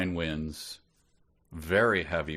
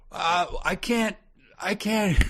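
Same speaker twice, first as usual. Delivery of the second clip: shouting over music; 5 dB louder than usual. Retold or imitated.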